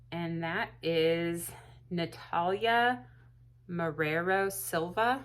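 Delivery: Natalia Marrero Silva sounded out slowly and carefully; careful name reading. Real, not acted. Announcing the giveaway winner, slow careful name pronunciation. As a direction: spell out Natalia Marrero Silva syllable by syllable, unsure. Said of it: "I totally butchered your name".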